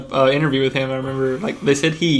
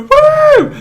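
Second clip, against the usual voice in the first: loud high cheer; excited whoop; 10 dB louder than usual. Real, not acted. Cheering himself on as number one.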